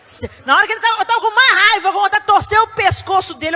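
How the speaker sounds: high, loud exasperation; half-laughing fury; mock rage. Recalling anger at son. (raiva exagerada)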